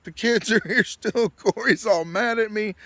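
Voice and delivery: high, loud mock complaint through a laugh; retold pleading. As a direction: imitate a frazzled friend begging for rescue. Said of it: "pleading with me to come down".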